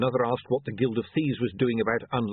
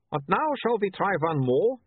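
brightly; 10 semitones higher than usual. Acted. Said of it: "he said brightly".

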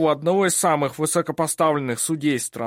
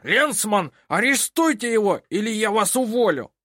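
much higher, louder angry order; furious judge; 11 semitones higher, 4 dB louder than usual. A narrator's reading. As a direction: throw them out in rage. (судья в ярости выгоняет их)

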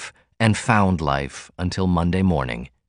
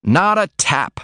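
proud announcement; 7 semitones higher, slower than usual. Acted. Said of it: "he announced".

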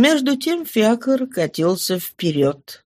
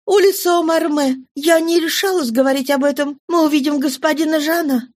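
put-on higher, lighter girl's voice; young girl voice; 8 semitones higher than usual. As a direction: speak as a young girl, lifted.

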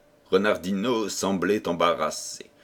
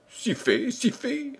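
hasty agreement; 13 semitones higher, 3 dB quieter, slower than usual. A narrator's reading. King hastily covering his mistake.

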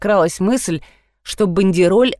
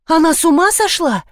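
high, stunned question; shocked gasp; 8 semitones higher than usual. A narrator's reading. Lida to Alla after being slapped, shocked. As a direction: gasp it in disbelief, pitch raised. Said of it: "ахнула Лида".

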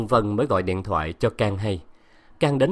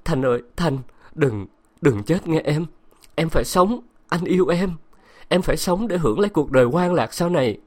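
as if crying; 5 semitones higher than usual. Acted. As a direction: beg her to live. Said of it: "nói như khóc".

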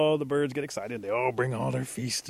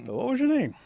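high, louder asking; insistent question; 12 semitones higher, 7 dB louder than usual. Acted. Bear to the departing creature, insistently asking its name. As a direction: press for the answer, pitch jumping up.

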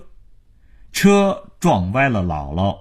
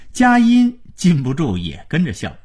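plain reading voice

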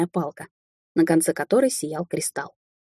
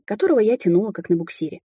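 plain reading voice

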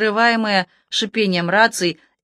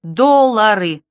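Доллары repeated louder and slowly, each syllable hammered; stress on Доллары; 3 semitones higher, 5 dB louder, much slower than usual. A narrator's reading. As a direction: spell out Доллары for a dim listener. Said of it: "Доллары у меня в сумке были".

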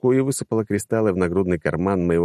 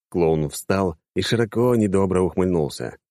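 ordinary delivery

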